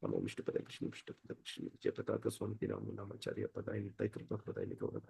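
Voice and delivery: very soft, rapid low murmur; murmured prayer. Real, not acted. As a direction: rattle the prayer off under your breath. Rapid private prayer after invocation.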